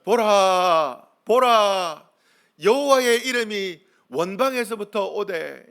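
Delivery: slow, ringing, drawn-out reading; loud scripture proclamation. Real, not acted. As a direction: call the congregation into the verse. Leads congregation in reading scripture.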